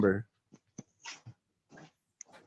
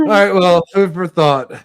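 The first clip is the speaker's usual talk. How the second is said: loud sarcastic; 8 semitones higher, 7 dB louder than usual. Real, not acted. Loud mocking reply in argument.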